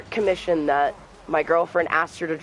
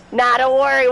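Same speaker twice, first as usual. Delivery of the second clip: higher and louder, cocky reassurance; hyped gamer boast; 9 semitones higher, 7 dB louder than usual. Real, not acted. Streamer hyping a play to the chat, loud and high. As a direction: brag loudly to the chat mid-fight. Gaming trash talk mid-play.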